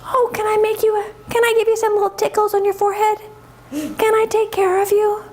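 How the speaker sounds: high, sweet little calf voice. Acted. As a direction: offer care in a tiny high voice. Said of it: "Bella is such a sweet calf".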